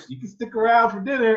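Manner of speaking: very high, loud mock woman's voice; put-on high female voice; friend's mom. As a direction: imitate an inviting mother in falsetto. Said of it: "she was like".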